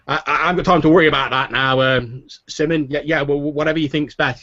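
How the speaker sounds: distracted, rushed innkeeper; harried innkeeper. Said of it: "Finnan's like".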